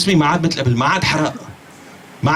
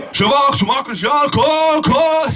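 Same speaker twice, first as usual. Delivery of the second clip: hammed-up relative yelling across the dinner table; mock family shouting; 11 semitones higher, slower than usual. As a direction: bark at the kid to eat, half laughing. Voicing family pushing food at table.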